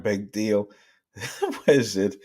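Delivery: ordinary delivery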